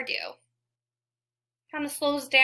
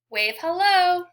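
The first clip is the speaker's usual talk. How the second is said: cheery call; 6 semitones higher, 6 dB louder than usual. Shown as a demonstration. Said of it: "Everybody wave hello".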